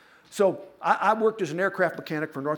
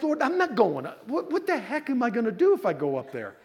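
whiny protest; 7 semitones higher than usual. Retold or imitated. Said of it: "And so I'm like".

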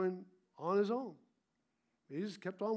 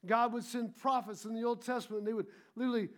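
a preacher's rising call-and-response fervor; full-force preaching; 3 semitones higher, 4 dB louder than usual. Acted. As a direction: press it home to the congregation.

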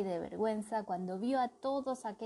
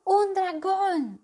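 high, loud and slow with delight; excited exclamation; 11 semitones higher, 9 dB louder, much slower than usual. Acted. The princess thrilled to hear of a dragon, high and excited. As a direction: gasp it out in thrilled wonder. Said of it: "exclamó".

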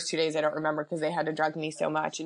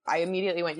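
ordinary talk

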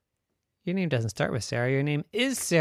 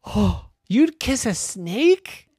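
higher, slow, amazed; shocked little girl; 10 semitones higher, 8 dB louder, slower than usual. Acted. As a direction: gasp out the question in disbelief. Mary shocked by kiss idea.

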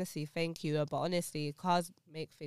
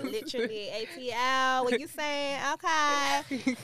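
higher-pitched Atlanta hype; Atlanta accent; 6 semitones higher, 4 dB louder, slower than usual. Acted. Co-host hamming up Atlanta slang for friends, high Atlanta accent. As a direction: play an Atlanta local, pitched up.

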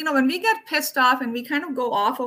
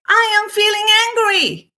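shouted, much higher and louder; angry from the body; 10 semitones higher, 5 dB louder than usual. Shown as a demonstration. Showing how to say real anger out loud, loud and high. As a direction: let the anger burst out in the voice. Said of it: "You're gonna say".

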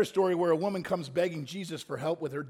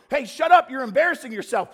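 louder, higher mock bystander snapping at the beggar; scolding bystander; 5 semitones higher, 8 dB louder than usual. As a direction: hush the beggar, embarrassed.

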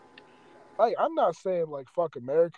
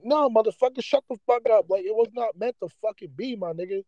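heated, higher-pitched outburst; worked-up anger; 4 semitones higher than usual. Real, not acted. Rebuking people who say it was meant to be, angrily. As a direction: cut them off, exasperated and emotional. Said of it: "I'm getting mad emotional".

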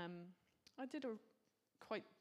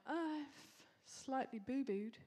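mock flippant; 5 semitones higher than usual. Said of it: "He's not like".

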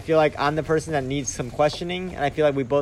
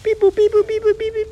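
high-pitched mock dial tones; phone dialing noises. Host pretending a guest is phoning in, dialing noises. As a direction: beep and boop like a phone dialing. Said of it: "He's phoning in right now".

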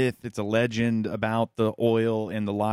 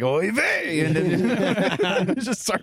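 put-on high, slow Yiddish accent; hammed-up Yiddish oy vey; 10 semitones higher, 3 dB louder, much slower than usual. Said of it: "You gotta be like".

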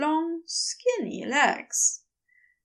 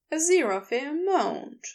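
ordinary delivery

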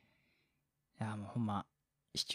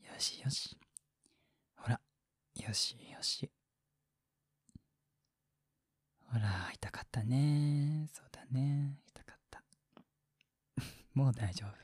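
put-on caring boyfriend voice; 2 semitones higher, slower than usual. Shown as a demonstration. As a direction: whisper comfort to a sick partner. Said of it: "例えばどんなこと言うの？".

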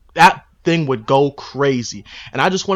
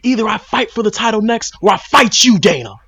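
higher, louder fighter's callout; bold; 7 semitones higher, 4 dB louder than usual. Acted. Pundit voicing Leon calling out Dana, bold challenge. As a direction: lay down a bold ultimatum to camera. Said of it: "he needs to be bold".

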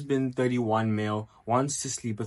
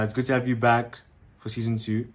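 ordinary talk, nothing marked

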